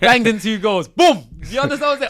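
loud, laughing, high-pitched boast of scoring; laughing as he crows about his two goals; excited. Real, not acted. Proud prisoner laughing to his friend about scoring, loud and high.

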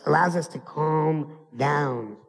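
normal talking voice

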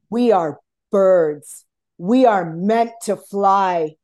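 slow, loud, solemn pronouncement; a father telling his daughter to fly, slow and grand; dad's voice. Retold or imitated. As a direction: declare it slowly like a father who knows. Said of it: "And he's like".

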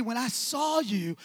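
ordinary talk

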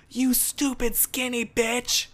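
high, slow sneering insult in a put-on voice; a mock-outraged stranger, drawn out and pitched high; mocking angry man. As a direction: mimic the guy spitting out the slur. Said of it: "what he said was".

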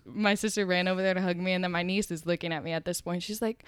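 normal talking voice

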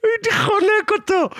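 very high voice, breaking into laughter; laughing squeal. Real, not acted. Cracking up over the annoying hipster, high laughing squeal. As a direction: squeal it through real laughter.

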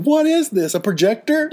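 put-on very high-pitched child voice; imitating puzzled kids. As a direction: squeak it like a baffled kid. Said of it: "Our kids are like".

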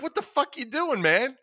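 high-pitched, incredulous protest; mock outrage. Man catches his friend on the couch, mock outrage. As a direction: play appalled, half cracking up. Acted shocked reply to friend.

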